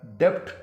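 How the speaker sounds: डेब्ट with b sounded, rising; the common slip: डेब्ट with a b; mistaken "debt". Shown as a demonstration. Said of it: "या डेबिट pronounce कर देते हैं".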